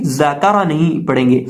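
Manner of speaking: ordinary talk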